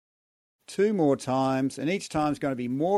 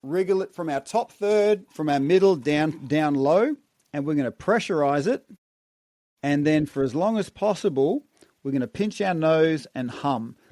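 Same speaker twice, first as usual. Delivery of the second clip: coaching the breath, louder and energized; guided breathing coach; 4 dB louder than usual. Shown as a demonstration. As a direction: call out each breathing step firmly.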